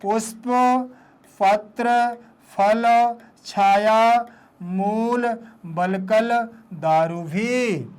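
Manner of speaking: each word separate, with a pause after it; unhurried word by word. Shown as a demonstration. Teacher has pupils pronounce at ease, word by word. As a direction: enunciate patiently, one word at a time. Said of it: "इसका उच्चारण कर लें आराम से".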